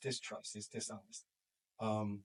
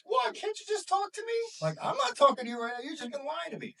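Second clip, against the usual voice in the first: put-on much higher, louder, a defiant teenager snapping back; whiny teen outburst; 8 dB louder than usual. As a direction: play the fed-up kid yelling at his parents. Kid voiced in heated argument.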